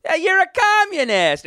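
high, drawn-out mock denunciation; loud theatrical finger-pointing call; mock accusation. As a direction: call out a traitor in a long high cry. Mock accusation of a comrade, acted.

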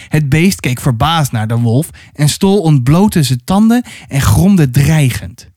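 usual speaking voice

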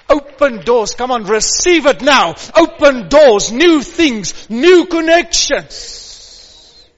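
loud, rising fervent preaching; preaching at full force. Real, not acted. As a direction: stretch the last words out.

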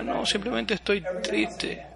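mock the gloomy hotel clerk; mopey sad clerk. Hotel clerk tells the excited guest he is sad, softly. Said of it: "Me decía".

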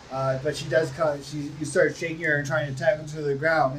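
ordinary delivery

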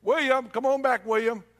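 imitating a receptionist calling a patient.

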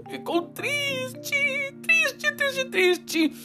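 high, slow whimpering sad voice; crying voice. A narrator's reading. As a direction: wail the word triste like a crying child. Narrator mimics sad crying. (voz chorosa e aguda)

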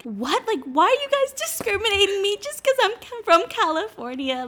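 high, indignant mock disbelief; a high, outraged "what, why me" retelling; incredulous outburst. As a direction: squeal it like you can't believe it. Mock outrage at bank refusal.

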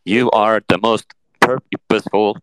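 slow robot voice breaking down; jammed robot. Acted. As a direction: glitch like a jamming chatbot. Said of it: "it gets jammed".

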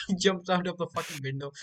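normal talking voice

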